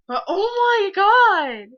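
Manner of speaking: high, loud, drawn-out squeal; giddy squeal. Real, not acted. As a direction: burst out with giddy excitement. Giddy outburst about her crush.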